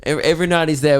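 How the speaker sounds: usual speaking voice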